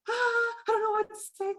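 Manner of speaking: hammed-up freak-out. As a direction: blurt it in mock panic. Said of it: "when you freak out".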